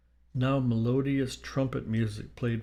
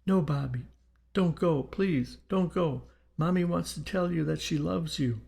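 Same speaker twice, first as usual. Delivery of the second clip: higher-pitched, urgent begging; pleading mother; 6 semitones higher than usual. A narrator's reading. Mother to her departing dead son, pleading. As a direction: plead with him to stay. Mother begs spirit not to leave.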